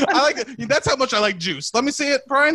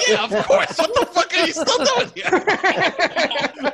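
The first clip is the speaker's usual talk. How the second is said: everyone's mock reaction; 8 semitones higher, slower than usual. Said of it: "everyone goes".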